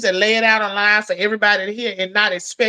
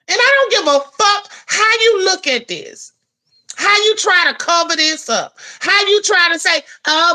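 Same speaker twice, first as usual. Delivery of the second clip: angry rant; 6 semitones higher, 4 dB louder than usual. Real, not acted. Continued rant at Miss Miles.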